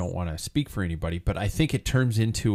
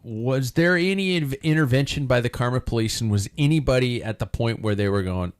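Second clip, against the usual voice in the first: ordinary talk, nothing marked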